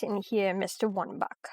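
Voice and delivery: hushed unvoiced whisper of the name. Whispering to a colleague that the visitor is listening. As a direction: breathe the name so only he hears.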